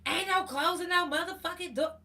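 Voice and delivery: loud, high angry yell; yelling neighbor. Retold or imitated. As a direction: bellow it like you're losing control. Neighbor yelling at police scene.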